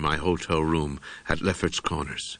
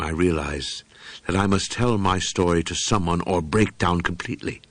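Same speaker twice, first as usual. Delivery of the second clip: plain reading voice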